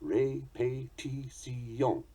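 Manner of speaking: "répétition" very slowly, syllable by syllable; French equal stress. Shown as a demonstration. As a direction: spell out "répétition" as ray-pay-tee-see-yon, evenly. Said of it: "equally stressed in French".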